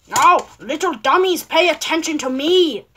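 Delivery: swooping, punched-up character voice; Baldi. Acted. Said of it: "That's my best Baldi voice".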